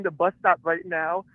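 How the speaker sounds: ordinary delivery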